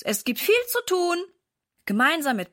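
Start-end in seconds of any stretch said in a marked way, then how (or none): 0.0-1.2 höher gerufen, leicht lauter; rufen. A narrator's reading. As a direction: durchs Haus rufen, wie ein Kommando. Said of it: "ruft er durch das ganze Haus". (Papa ruft die Kinder zum Aufräumen, höher gerufen)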